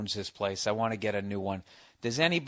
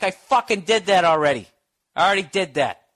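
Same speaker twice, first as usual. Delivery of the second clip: loud, exasperated snapping back at dad; retold yelling; 7 semitones higher, 6 dB louder than usual. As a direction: protest hotly, pitched up. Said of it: "I yelled at him so much".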